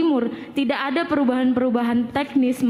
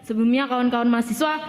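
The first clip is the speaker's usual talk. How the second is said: ordinary talk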